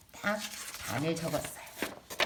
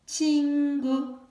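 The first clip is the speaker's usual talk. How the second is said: slow model word; 9 semitones higher, 8 dB louder, much slower than usual. Shown as a demonstration. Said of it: "다 같이 해볼까요?".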